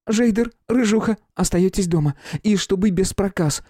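stern little warning to pets; scolding young woman. A narrator's reading. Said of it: "погрозила княжна Бельчихе". (строгая девушка грозит)

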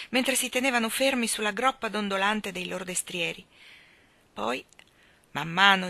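ordinary delivery

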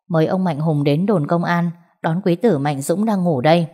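usual speaking voice

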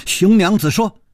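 ordinary delivery, nothing marked

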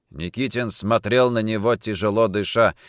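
ordinary delivery

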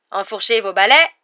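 loud call to the players; referee's command. A narrator's reading. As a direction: give the starting order across the pitch. Referee starts the match. (consigne forte d'arbitre)

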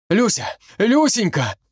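high anxious calls; calling out. A narrator's reading. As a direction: call her as you burst in. Kolka calls rushing in.

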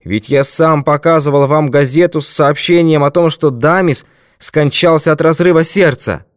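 high, loud protest; agitated shouting. A narrator's reading. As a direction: shout your defence in agitation. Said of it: "крикнул он".

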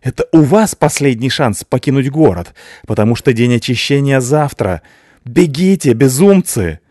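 high, loud triumphant threat; gloating shout. A narrator's reading. Father shouts threats at the police, high and loud. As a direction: warn them off with a menacing shout.

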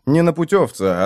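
plain reading voice

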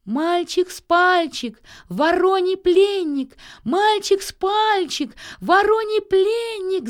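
high, loud, slow chant; shouting from above. A narrator's reading. Nils, carried by crows, calls down to a thrush, high drawn-out shout. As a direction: call it down from the sky, drawing out each word. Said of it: "закричал сверху Нильс".

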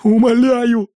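high, pleading whine; whining plea. A narrator's reading. Engineer begging the captor to negotiate, whining. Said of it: "ныл инженер".

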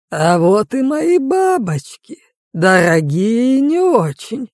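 slow, syrupy voice with a rolled French r; sweetly menacing with a burr; unctuous burring villain. A narrator's reading. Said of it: "елейно начал он, откровенно картавя".

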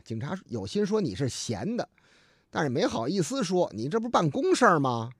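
normal talking voice

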